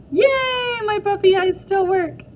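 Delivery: high, loud happy squeal; delighted cheer. Acted. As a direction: cheer like a kid whose trick worked. May delighted her trick worked.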